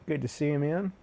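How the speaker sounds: usual speaking voice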